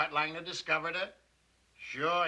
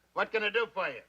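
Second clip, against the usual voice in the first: ordinary delivery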